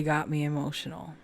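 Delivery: whispered, moved and shaken; emotional whisper. Real, not acted. Explaining to viewers why she is emotional, whispering. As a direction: breathe it out after being overwhelmed. Overcome after an intense reading.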